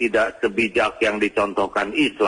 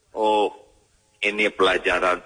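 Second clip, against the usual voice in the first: ordinary talk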